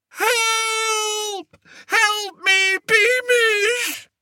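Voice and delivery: very high crying cry for help; terrified shout. A narrator's reading. Lost king shouting for Beamish in terror. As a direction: wail for rescue in panic. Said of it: "shouted King Fred".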